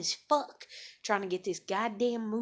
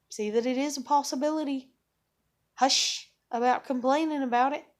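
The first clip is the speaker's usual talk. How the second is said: higher and louder, pressing them to look; raised insistent voice; 4 semitones higher, 4 dB louder than usual. Retold or imitated. To family who keep complaining, raised insistent voice. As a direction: urge them to see the progress, loud and high. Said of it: "And I'm like".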